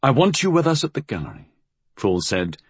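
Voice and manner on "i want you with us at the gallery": quick, higher, curt order; brisk and a little curt. A narrator's reading. Fool orders Flood to join them, curt. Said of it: "his voice was curt".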